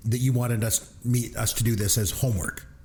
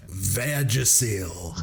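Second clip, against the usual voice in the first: drawn-out shout of "Vagisil"; mock ballplayer bellowing "Vagisil"; slow emphatic one-word quote; 4 semitones lower, much slower than usual. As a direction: announce it proudly and slowly. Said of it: "the way he says it".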